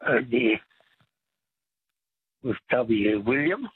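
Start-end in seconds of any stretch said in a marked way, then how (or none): none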